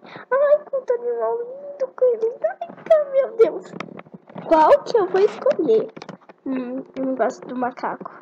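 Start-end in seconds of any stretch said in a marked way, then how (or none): 0.1-3.7 high, excited gushing; gushing delight. Real, not acted. Girl gushing at the animal selection screen. Gushes over animal choices. 4.5-5.9 excited question. Real, not acted. Excited choosing an animal.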